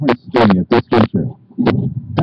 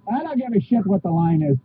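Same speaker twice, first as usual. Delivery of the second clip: ordinary delivery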